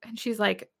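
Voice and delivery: ordinary talk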